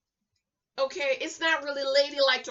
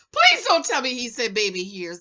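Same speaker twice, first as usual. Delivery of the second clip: usual speaking voice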